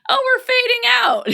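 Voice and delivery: high, loud, sing-song mock voice of the friend; mock oblivious friend. Mocking the friend who misses the fade-out, high and loud. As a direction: jump way up to mock the clueless friend. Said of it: "they're not going".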